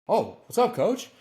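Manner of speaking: high, bright-eyed "oh, what's up, coach?" with a rising end; surprised reply. Retold or imitated. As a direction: answer the coach like you're caught off guard, pitched well up. Surprised reply to coach calling him.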